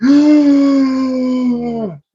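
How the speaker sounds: sharp, high, breathless gasp; loud lovestruck gasp; startled gasp. Pretend. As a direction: gasp as if struck by sudden love. Smitten spy meets the American.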